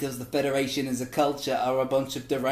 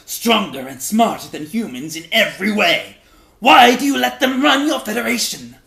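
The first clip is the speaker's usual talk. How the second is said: building from loud to a much higher, louder bellow; growing Klingon shout; 6 semitones higher, 9 dB louder than usual. Acted. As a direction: demand it like an outraged warrior. Klingons quizzing the Vulcans.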